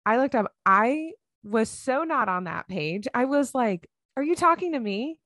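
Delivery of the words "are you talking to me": surprised. Retold or imitated. Surprised stranger complimented her.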